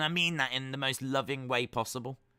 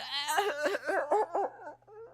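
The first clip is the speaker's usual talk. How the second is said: high laugh. Real, not acted. Laughing after his joke.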